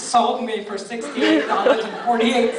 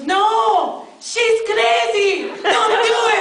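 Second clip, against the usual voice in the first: high, urgent fatherly warning; mock frantic dad; alarmed dad; 9 semitones higher, 4 dB louder, much slower than usual. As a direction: warn him off like a panicked father. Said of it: "my dad's first response was".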